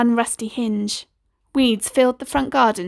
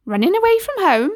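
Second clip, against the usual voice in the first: a hint of a motherly neighbour, higher; kindly neighbour voice; 9 semitones higher, 4 dB louder than usual. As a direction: Missus Cole asks the runaway boy, lightly motherly. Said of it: "said Missus Cole".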